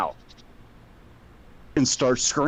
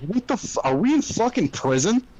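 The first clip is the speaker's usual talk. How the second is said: disbelief; 10 semitones higher than usual. Retold or imitated. Father's own disbelief in car.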